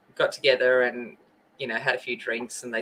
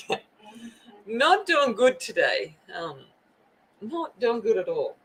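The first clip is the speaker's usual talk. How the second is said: emotional; 7 semitones higher than usual. Real, not acted. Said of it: "now I'm gonna get emotional".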